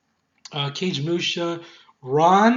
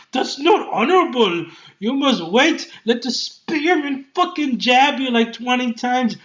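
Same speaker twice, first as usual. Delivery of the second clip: mock uncle lecture; 6 semitones higher, 4 dB louder than usual. Mocking the uncle's honor lecture.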